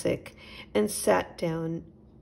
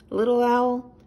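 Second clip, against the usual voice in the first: lightly raised, kindly chipmunk voice; a gentle host offering milk; a hint of a friendly little chipmunk; Nigel the chipmunk; 5 semitones higher, 6 dB louder than usual. A narrator's reading.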